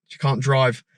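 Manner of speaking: ordinary talk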